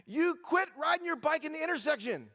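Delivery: high, loud scolding holler; mom yelling. Retold or imitated. Mom yells at her boy in front of his friends, loud scolding yell. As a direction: yell it out like an angry mother. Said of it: "she yells out in front of all his friends".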